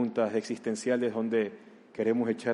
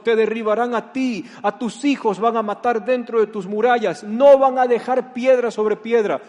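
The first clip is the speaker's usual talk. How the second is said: building lament; 8 semitones higher, 7 dB louder than usual. Acted. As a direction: lament building from firm to loud, higher warning. Jesús llora sobre Jerusalén. (lamento que crece hasta un clamor fuerte y agudo)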